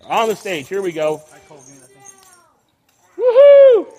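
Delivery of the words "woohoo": loud, high celebratory cheer; excited whoop. Real, not acted. Cheering the children after their song, loud high whoop.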